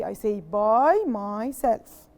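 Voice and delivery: slow model sentence, each word clear; slow model answer. Shown as a demonstration. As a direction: say "by myself" slowly for the class. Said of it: "So what do I say?".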